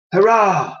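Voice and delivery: cheering. Acted. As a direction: cheer like a happy crowd. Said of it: "A cheer went up".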